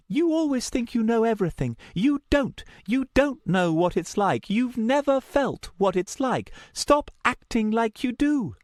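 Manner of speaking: high, heated tirade; furious high-pitched accusation. A narrator's reading. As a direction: let fly in a high, angry voice. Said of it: "She'd never before even raised her voice".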